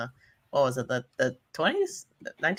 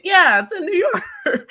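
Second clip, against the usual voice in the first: much higher and louder, slow, mocking imitation; mock friend quote; 5 dB louder than usual. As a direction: mimic a friend, squeaky and smug. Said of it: "but he's like".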